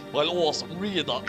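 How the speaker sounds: normal talking voice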